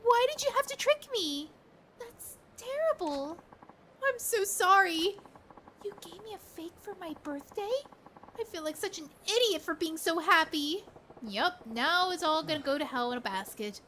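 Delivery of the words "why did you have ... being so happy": higher, wounded and upset; hurt girlfriend. Acted. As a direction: let the betrayal crack through. Girlfriend learns it is fake.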